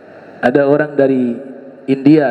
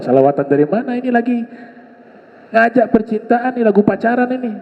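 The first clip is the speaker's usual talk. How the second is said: higher, incredulous scolding; rebuking teacher; 6 semitones higher, faster than usual. Retold or imitated. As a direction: scoff at the excuse and scold. Teacher rebukes mistaken salawat claim. (guru memarahi pemutar lagu di masjid dengan nada tinggi)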